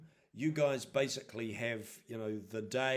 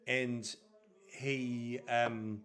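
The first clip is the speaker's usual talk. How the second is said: ordinary talk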